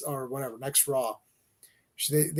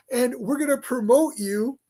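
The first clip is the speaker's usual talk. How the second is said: higher, hammed-up corporate gushing with laughter; gushing fake praise; 6 semitones higher, 3 dB louder than usual. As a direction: oversell the praise, grinning through it. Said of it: "It's like".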